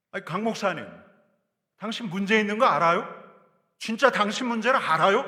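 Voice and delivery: loud, high accusation at the pastor; accusing challenge. Acted. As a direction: call him out to his face, loudly. Accusation voiced again, louder. (목사에게 따지듯 큰 소리로)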